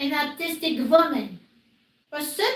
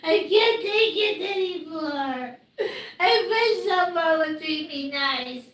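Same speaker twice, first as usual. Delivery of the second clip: crying; 2 semitones lower than usual. Real, not acted. Harassed streamer wishing for nicer treatment, crying. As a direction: moan it through sobs. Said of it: "I'm crying".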